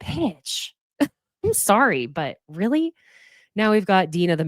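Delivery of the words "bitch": muttered insult. Real, not acted. Muttered insult after imitation.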